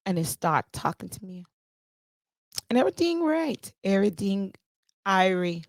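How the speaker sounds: put-on Jamaican patois; Jamaican-style accent. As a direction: slip playfully into a Jamaican accent. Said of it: "catching this accent".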